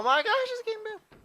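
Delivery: surprised exclamation. Real, not acted. Final boss appears.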